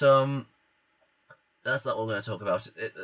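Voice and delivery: ordinary talk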